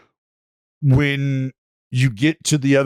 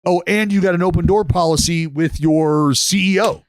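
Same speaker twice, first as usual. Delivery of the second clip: ordinary talk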